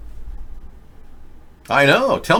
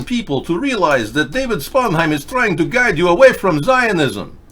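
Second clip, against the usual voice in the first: hammed-up Russian accent, pitched higher; mock Russian accent; 8 semitones higher than usual. Host mocking Russians in a put-on Russian accent. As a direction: play a broadcaster faking a Russian accent. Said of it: "I even do a better Russian accent than you people do".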